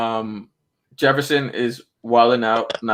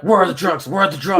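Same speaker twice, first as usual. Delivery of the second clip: imitating cops, pitched up and insistent; mock cop demand; 7 semitones higher, faster than usual. Podcaster retelling cops confronting a kid, mock demanding. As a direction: play an urgent, high-pitched cop interrogation. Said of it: "the cops pull up and they're like".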